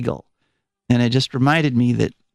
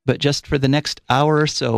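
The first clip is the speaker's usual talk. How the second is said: usual speaking voice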